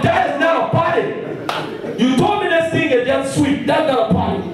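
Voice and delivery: high-pitched mock indignant outcry; retold indignant protest. Preacher replays complaining to his drinking friend, mock indignant. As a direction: protest far up high, as if wronged.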